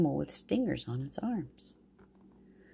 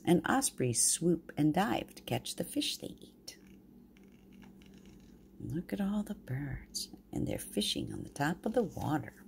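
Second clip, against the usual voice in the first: soft hushed pointing out; whispered aside; 6 dB quieter than usual. Real, not acted. Grandma pointing at the pictures for the babies, whispered. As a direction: whisper it like sharing a secret.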